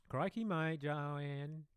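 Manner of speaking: deliberately awful Australian drawl; bad Australian accent. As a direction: ham up a terrible Australian accent. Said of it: "that was my worst Australian accent".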